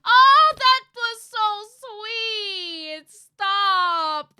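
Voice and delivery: very high, very loud sung squeal, stretched out; gushing squeal. Real, not acted. Streamer overwhelmed by the daughter's sweet line, high loud squeal. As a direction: melt into a singing squeal.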